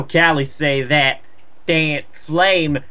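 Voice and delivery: usual speaking voice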